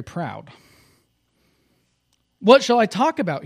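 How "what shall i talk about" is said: high, loud and eager; eager question. Acted. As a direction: ask it like an excited little rabbit. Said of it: "he asked eagerly".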